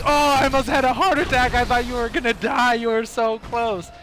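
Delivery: high-pitched, acted-out freak-out; shaken scolding. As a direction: tell off your buddy after the scare. Acted scolding after scare.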